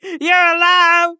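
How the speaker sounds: overjoyed sob-shout; joyful cry. Pretend. Player greeting his revived friend with a high tearful joyful cry.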